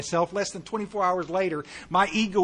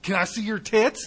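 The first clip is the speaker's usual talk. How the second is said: laughing; 5 semitones higher, 3 dB louder than usual. Acted. Joking mishearing, laughing.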